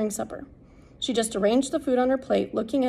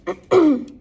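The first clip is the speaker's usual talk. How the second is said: a short loud throat-clear; 7 semitones higher, 9 dB louder than usual. Real, not acted. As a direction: clear your throat before reading on.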